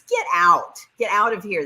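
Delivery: high, loud burst of amazement; playful disbelief. Real, not acted. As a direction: exclaim it like you can't believe it. Mock disbelief at a viewer's comment.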